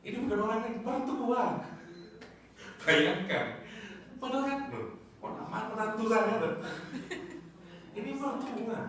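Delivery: preacher marvelling to the congregation, voice rising high; incredulous exclaiming. Real, not acted. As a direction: stress how unthinkable it is.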